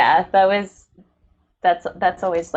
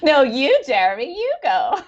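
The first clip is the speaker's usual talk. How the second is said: laughing; 11 semitones higher than usual. Real, not acted. Laughing, urging co-host to speak.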